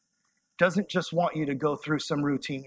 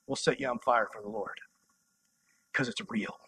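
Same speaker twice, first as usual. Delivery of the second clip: much softer, lower, drawn in close; soft intimate; 6 semitones lower, 6 dB quieter than usual. Real, not acted. Preacher closing his point to the youth, softly. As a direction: pull the room in with a hush.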